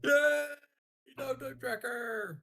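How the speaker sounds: high, loud, slow gleeful cheer; excited cheer. Pretend. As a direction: cheer it like a kid. Mock cheering at downtime tracker.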